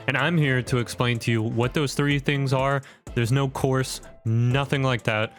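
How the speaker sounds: ordinary delivery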